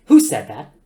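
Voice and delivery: loud offended nanny voice; indignant computer demand. A narrator's reading. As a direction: demand it like a scolding schoolmarm. Computer demands who spoke.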